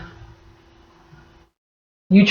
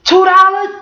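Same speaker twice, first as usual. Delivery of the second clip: much higher "Dead ass? Two dollars?" in shock; delighted disbelief at a bargain; incredulous; 12 semitones higher, slower than usual. Shown as a demonstration. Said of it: "You like".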